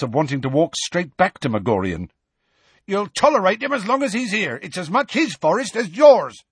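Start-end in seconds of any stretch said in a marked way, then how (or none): 2.9-6.4 high, loud, furious retort; yelling. A narrator's reading. Hagrid answering Magorian's parting warning, yelling. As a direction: yell back in defiance. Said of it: "he yelled".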